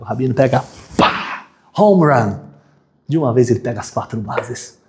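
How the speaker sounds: ordinary delivery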